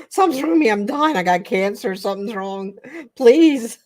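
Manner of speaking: high, loud mock panic, pleading at the end; hammed-up panic. Retelling her hospital beet scare to viewers, hammed-up pleading panic. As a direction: ham up the dying patient, begging for help. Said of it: "I'm, like, at the hospital".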